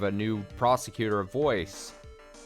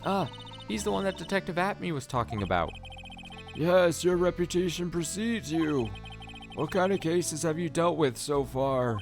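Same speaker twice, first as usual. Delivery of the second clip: judge voice; 8 semitones higher than usual. Acted. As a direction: ask it like an impressed judge.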